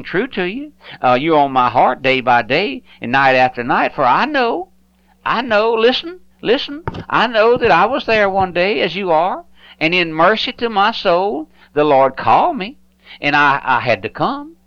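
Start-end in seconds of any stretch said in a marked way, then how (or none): none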